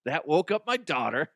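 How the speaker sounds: usual speaking voice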